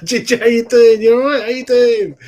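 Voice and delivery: loud, high, delighted hello; real burst of loud joy at a familiar name; excited greeting. Real, not acted. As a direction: greet him like a long-lost friend.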